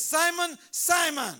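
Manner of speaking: high, loud shout of the name; shouted call. Acted. Jesus calling Simon, loud shout. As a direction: shout his name to wake him. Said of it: "Jesus says".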